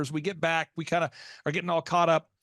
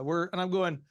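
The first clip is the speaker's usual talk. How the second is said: usual speaking voice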